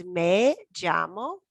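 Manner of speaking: slow, drawn-out me llamo; teacher modelling "me llamo" slowly; slow model phrase. Shown as a demonstration. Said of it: "the answer to that is".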